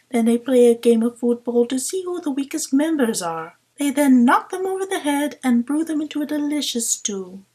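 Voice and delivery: high, conspiratorial liar's voice; sly deceiver voice. Pretend. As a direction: tell a tall tale with relish. Imagined liar tricking aliens.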